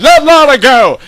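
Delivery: high, loud demand at the gates; shouted demand. Acted. Heroes shouting up to the Dark Lord to free Lorna. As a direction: shout it up at the fortress.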